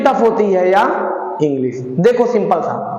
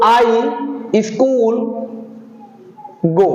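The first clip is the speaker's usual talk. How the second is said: slow encouraging demo; much slower than usual. Shown as a demonstration. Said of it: "कोई बात नहीं बेटा".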